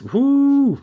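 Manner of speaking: high, loud celebratory whoo; a hooting cheer of agreement; excited whoop. Real, not acted. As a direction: let out a gleeful whoop. Cheering at kid-selling joke.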